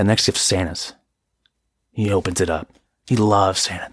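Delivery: usual speaking voice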